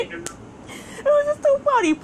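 high, slow, breaking into laughing sobs; cry-laugh the words out. Real, not acted. Teller repeating it was too funny, cry-laughing.

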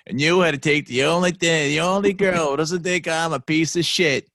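high, loud, overdone mock accent; imitating a hammed-up movie accent of unclear origin; Ashton Kutcher's over-the-top accent. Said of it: "his accent there is just so, like, over the top".

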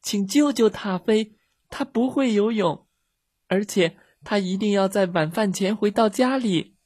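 put-on high-pitched child's plea; loud calling. A narrator's reading. Girls begging for the dog's rescue, calling loudly. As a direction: plead for help in a high, urgent voice. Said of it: "米莉和茉莉大声喊道".

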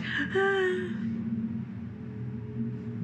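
long high breathy sigh, no voice; airy unvoiced sigh of sudden relief; drugged sigh. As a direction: let it out like the drug just hit. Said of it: "they go like".

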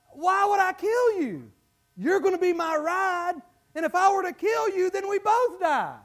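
high-pitched mock-reasonable pleading; persuasive scorpion. Scorpion talking the frog into a ride, mock-reasonable. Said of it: "and the scorpion says".

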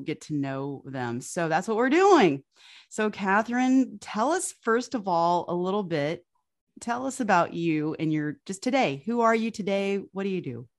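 normal talking voice

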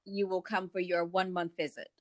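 ordinary talk, nothing marked

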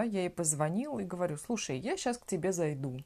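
usual speaking voice

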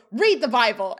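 mock yell, loud and laughing. A narrator's reading. Sean's parting shot read aloud, mock yell. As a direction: yell it out for comic drama. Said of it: "He points again and yells".

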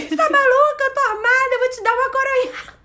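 high, agitated mock robber outburst; imitated angry threat. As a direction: play an exasperated robber scolding a victim. Acting out the armed robber's threat.

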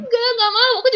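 mock pouty teenage refusal; childish refusal. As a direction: refuse like a pouting kid. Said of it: "Tapi aku kayak".

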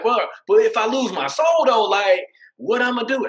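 high, loud, pleading protest; pleading retort. Retold or imitated. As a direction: throw the question back hard and high.

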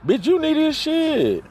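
high, loud furious outburst; angry outburst. Real, not acted. Snapping back at her boyfriend's complaint, high angry yell. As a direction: throw it back at him, shouting. Ranting at absent partner.

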